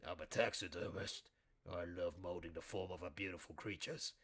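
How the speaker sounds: a creepy taxidermist pig purring offers; deep voice. Pretend. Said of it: "that kind of deep".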